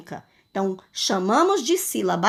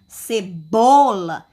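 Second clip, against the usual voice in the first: "cebola" slowed, leaning on a long "bo"; middle syllable stressed; 3 semitones lower, 5 dB louder, much slower than usual. Shown as a demonstration. Teacher pronouncing for pupils, "cebola" with "bo" stretched and stressed. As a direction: put force on "bo" in "cebola". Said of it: "eu tenho uma sílaba forte".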